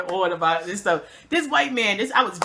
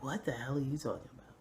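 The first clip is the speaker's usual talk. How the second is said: baffled, under breath; 8 semitones lower, 16 dB quieter than usual. Retold or imitated. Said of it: "staring at him like".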